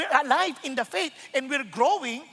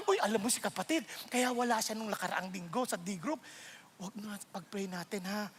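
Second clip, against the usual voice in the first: softer, confiding murmur; hushed gossip; 5 semitones lower, 9 dB quieter than usual. Retold or imitated. Pastor imitating a church gossip, hushed. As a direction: whisper it like a secret. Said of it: "gossip imitation".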